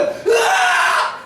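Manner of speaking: long, high, shouted scream of fright; terrified scream. Retold or imitated. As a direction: scream as if seeing a ghost on the water. Disciples screaming at figure on water.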